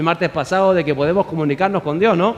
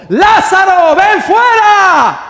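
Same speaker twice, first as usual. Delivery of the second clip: high, slow, booming shout; a commanding cry across the tomb; loud command; 12 semitones higher, 10 dB louder, much slower than usual. Acted. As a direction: call the dead man out at full voice. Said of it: "gritó con fuerte voz".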